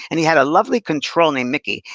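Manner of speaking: usual speaking voice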